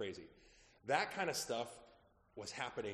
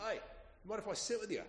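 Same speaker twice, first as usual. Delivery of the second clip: lightly voiced woman, higher pitched; a casual, friendly stranger's ask, pitched up; pub woman asking; 10 semitones higher than usual. Woman from pub asks to join.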